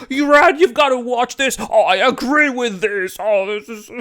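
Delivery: a hint of gushing viewers, high and loud; lightly imitated Discord fans gushing; mock eager fans. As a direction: mimic the fans raving. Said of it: "you guys told me on my Discord".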